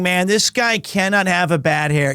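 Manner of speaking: normal talking voice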